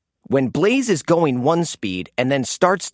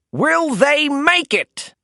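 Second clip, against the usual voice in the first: much higher, slow announcer call; announcer's suspense; 10 semitones higher, slower than usual. A narrator's reading. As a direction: build suspense for the crowd. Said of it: "asked the announcer".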